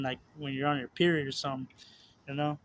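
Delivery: usual speaking voice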